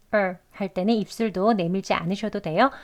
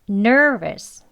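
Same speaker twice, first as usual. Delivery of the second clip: nervous with ner said louder; stress on ner; 5 dB louder than usual. Shown as a demonstration. Teacher repeats nervous with stress up front. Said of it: "앞에 강세가 있고".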